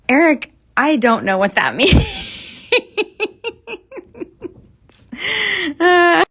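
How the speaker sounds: laughing out loud at a viewer's comment; laughing reaction. Real, not acted. Host reading a viewer's odd comment, laughing out loud.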